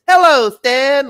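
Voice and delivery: very high, slow, prim little greeting; a toddler speaking like a polite adult, drawn out; toddler with a grown-up voice. Retold or imitated. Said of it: "in a perfect grown-up voice".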